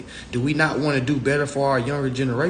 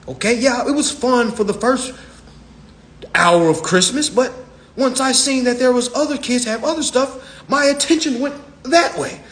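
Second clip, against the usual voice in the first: put-on high, loud kid voice; kid voice continued; 10 semitones higher, 4 dB louder than usual. Little [name] telling Mommy off, high bratty kid. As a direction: sass mom like a bratty kid. Child [name] keeps talking.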